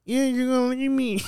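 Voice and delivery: high, put-on girlfriend plea; mock girlfriend, pitched high. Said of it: "So she was like".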